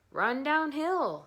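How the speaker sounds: high, loud and slow cow voice; cow suggesting. A narrator's reading. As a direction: draw it out slowly like a cow. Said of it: "suggested the cows".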